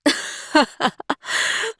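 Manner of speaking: high breathy cackle. Acted. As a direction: let out a mocking cackle. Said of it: "Miranda let out a cackle".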